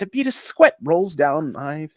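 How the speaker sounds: normal talking voice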